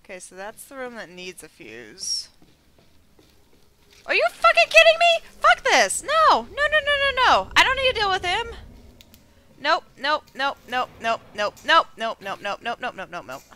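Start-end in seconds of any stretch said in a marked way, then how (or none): 4.1-8.5 panicked screaming, high and loud; scared horror game streamer. Real, not acted. Monster appears, panicked yelling. 9.6-13.4 breathless panicked string of nopes; scared horror game streamer. Real, not acted. Fleeing monster, rapid panicked nopes.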